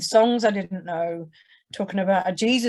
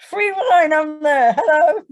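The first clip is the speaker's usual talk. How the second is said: high, loud, delighted squeal at free wine; gleeful squeal; 9 semitones higher, 8 dB louder, slower than usual. Retold or imitated. Past self squealing with joy at the free wine. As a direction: squeal it like the wine sold you.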